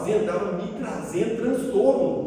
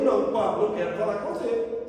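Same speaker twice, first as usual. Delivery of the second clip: usual speaking voice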